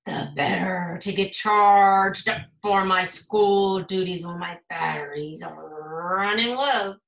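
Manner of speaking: a wolf making a smooth excuse; sly booming wolf granny. A narrator's reading. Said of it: "said Principal Granny".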